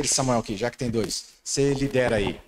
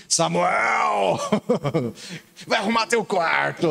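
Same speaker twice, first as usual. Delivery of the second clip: mock parent yell; 5 semitones higher, slower than usual. Parent calling son to tidy room.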